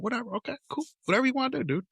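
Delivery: high, breezy whatever; casual dismissive dad; mock indifference. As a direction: shrug it off, sing it out high. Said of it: "So I'm like".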